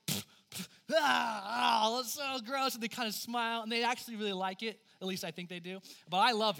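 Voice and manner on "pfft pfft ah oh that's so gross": spitting, then a high loud disgusted shout; kids grossed out. Retold or imitated. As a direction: spit and squeal like a grossed-out kid. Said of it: "They're like".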